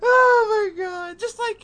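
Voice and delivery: high-pitched, slow "oh my god"; excited shriek. Real, not acted. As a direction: shriek it in disbelief. Reacting to absurd exaggeration.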